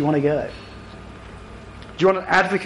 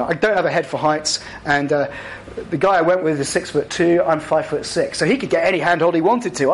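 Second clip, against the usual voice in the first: ordinary delivery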